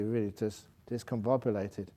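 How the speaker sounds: ordinary delivery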